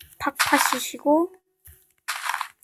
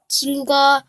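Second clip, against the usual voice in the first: usual speaking voice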